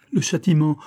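high, pained exclamation; tormented lament; anguished cry. A narrator's reading. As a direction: cry out at the punishment. First cry of punishment.

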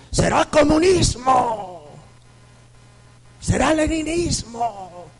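slow, high, booming mock scolding priest; mock priest shout. Priest indignantly questioning a woman who won't kneel, slow mock shout.

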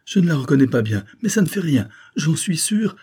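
high, loud excited certainty; excited cry. A narrator's reading. Coquentin recognizes his fiancée, excited cry. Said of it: "il s'écria".

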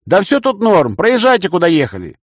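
loud high shout to outside. A narrator's reading. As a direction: shout it out the window. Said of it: "крикнул я".

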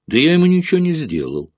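high, fast and outraged; exploding in anger. A narrator's reading. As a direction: blurt it out, stung by the accusation. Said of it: "Джонни взорвался".

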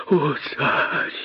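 soft, high imploring cry; pleading. A narrator's reading. Косой appeals to his king, pleading. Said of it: "взмолился Косой".

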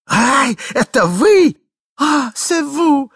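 high, loud, delighted exclamation; joyful cry. Acted. Glafira greeting her husband with loud high delight. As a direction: feign overjoyed surprise at her husband. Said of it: "закричала Глафира Петровна с неподдельной радостью".

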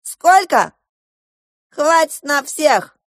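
loud, high retort; angry general. A narrator's reading. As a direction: throw the question back in outrage.

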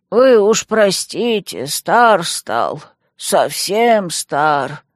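put-on slow, frail old man mumble; mumbling old man. A narrator's reading. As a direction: mumble like a worn-out grandpa. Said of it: "пробормотал дедок".

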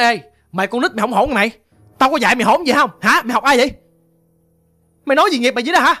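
mildly cross father's rebuke; light imitation of a father telling a child off; acted scolding. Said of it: "ổng chửi chị nữa".

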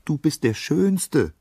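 very high, drawn-out chirping voice; high-pitched admiring bird; twittering birds. A narrator's reading. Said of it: "zwitscherten die Vögel zurück".

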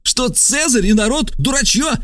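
very high-pitched cry; shouting accusation. A narrator's reading. Trasul denounces the stranger to lictors, high shouted accusation. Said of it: "крикнул Трасул".

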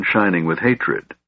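ordinary delivery, nothing marked

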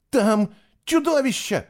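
high, alarmed gasp; gasped, out of breath. A narrator's reading. Fisher warning Eva of a monster, breathless. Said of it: "выдохнул Фишер и, не в силах отдышаться".

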